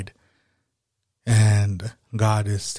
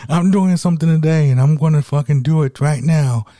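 loud, higher all-out yell of resolve; full shout; 6 semitones higher, 7 dB louder than usual. Pretend. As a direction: shout it like you mean it. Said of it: "just yell and say".

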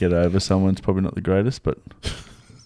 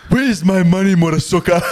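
mock scream; 10 semitones higher, 4 dB louder than usual. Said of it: "screaming at me".

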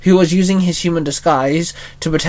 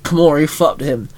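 plain reading voice

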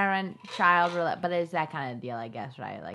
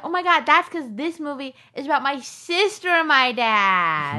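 higher, louder mock-overwhelmed outburst; retold emotional realization; 7 semitones higher, 5 dB louder than usual. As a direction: relive the revelation, hammed-up. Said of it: "I said".

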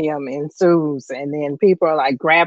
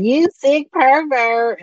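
angry; 7 semitones higher, slower than usual. Retold or imitated. Moms beating Howard with purses.

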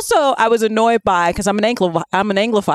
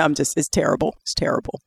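ordinary talk

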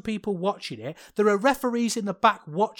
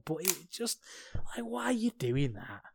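1 whispered frustration; 3 semitones lower, 11 dB quieter than usual. Real, not acted. Exasperated aside.

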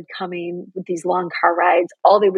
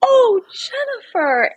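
high, slow, tearful imitation of mom; mom tearing up; slower than usual. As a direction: stretch out the words as mom starts crying. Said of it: "And now she's crying".

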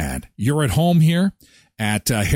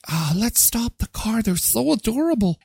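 gushing tourist; 8 semitones higher than usual. Retold or imitated. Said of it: "tourists are like".

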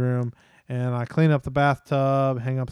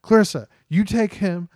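firm dad orders; 5 semitones higher, 4 dB louder than usual. Retold or imitated. Dad ordering son and wife.

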